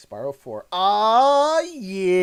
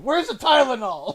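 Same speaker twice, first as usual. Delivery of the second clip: quoting a movie rant; 7 semitones higher than usual. Retold or imitated. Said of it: "he ends it with".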